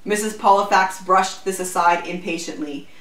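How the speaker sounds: ordinary delivery, nothing marked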